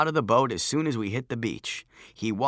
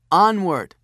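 commanding; 8 semitones higher, 9 dB louder than usual. A narrator's reading. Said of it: "he said".

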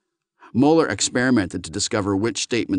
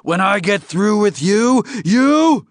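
high, loud threat climbing higher; furious alien at full blast; exploding rage; 12 semitones higher, 6 dB louder than usual. Acted. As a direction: shout the threat until it breaks off. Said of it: "Lars Wingetog exploded".

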